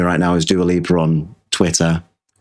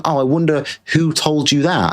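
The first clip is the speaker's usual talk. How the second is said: normal talking voice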